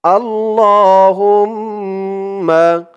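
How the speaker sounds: slow, drawn-out recited Arabic; model recitation. Shown as a demonstration. Teacher models the word for viewers to repeat, slow recitation. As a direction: stretch every syllable for them to copy. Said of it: "তো বলুন আমার সাথে".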